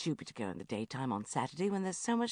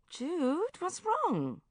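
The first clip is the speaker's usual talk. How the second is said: worried; 12 semitones higher, much slower than usual. Retold or imitated. Concerned question.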